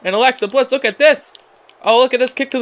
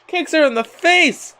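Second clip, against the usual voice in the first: excited call; 7 semitones higher than usual. Real, not acted. Calls the big kick.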